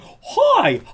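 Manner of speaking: squeaky fake-friendly sales pitch; mock salesman voice. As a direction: parody a pushy consultant's cold email in a high fake-friendly voice. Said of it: "people saying".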